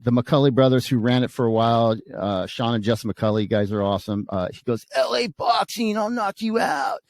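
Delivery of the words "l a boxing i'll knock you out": high, drawn-out retold threat; slow, high-pitched mock tough-guy yell; mock threatening shout. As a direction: imitate a rival boasting and calling out. Acting the rival's boastful threat.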